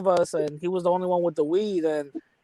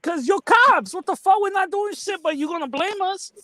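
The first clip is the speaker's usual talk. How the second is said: high indignant protest; 12 semitones higher than usual. Retold or imitated. Retold own indignant reply.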